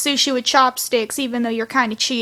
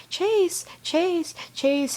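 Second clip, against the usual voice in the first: mother calling softly; 5 semitones higher, 7 dB quieter, much slower than usual. Retold or imitated. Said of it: "His mom repeating that over and over".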